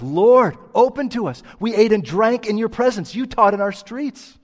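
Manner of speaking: high, loud desperate plea at a shut door; the crowd begging the master to open, loud and high; pleading crowd. Retold or imitated. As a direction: beg to be let in, loud and high. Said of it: "listen to them".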